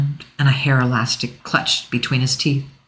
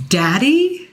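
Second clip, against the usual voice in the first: Daddy? asked higher and louder; 11 semitones higher than usual. A narrator's reading. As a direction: demand it, hurt and shocked. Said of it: "louder this time".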